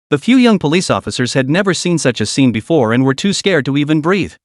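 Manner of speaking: usual speaking voice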